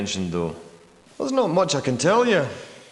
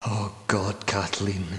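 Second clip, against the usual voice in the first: low, soft, stunned grief; grief-stricken; 6 semitones lower, 6 dB quieter, slower than usual. Acted. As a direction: let the name sink into quiet sorrow. Grieves on learning years passed.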